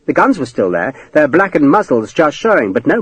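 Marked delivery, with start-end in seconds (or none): none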